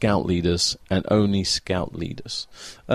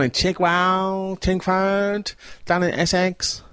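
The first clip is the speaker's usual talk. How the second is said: broad Essex accent, pitched up; 9 semitones higher, slower than usual. Shown as a demonstration. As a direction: put on a thick Essex accent. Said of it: "Do you like my Essex accent?".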